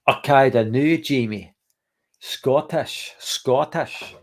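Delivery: mock Scottish brogue; Scottish accent. Shown as a demonstration. Streamer teasing Scottish viewer Jamie in the chat, imitation Scottish accent. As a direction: put on your best Scottish accent. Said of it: "That's my best impression of a Scottish accent".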